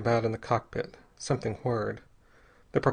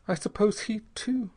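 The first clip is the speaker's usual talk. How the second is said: put-on higher-pitched girl's voice, drearily; dreary girl, raised pitch; 9 semitones higher than usual. A narrator's reading. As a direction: explain drearily, pitched well up. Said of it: "said the girl drearily".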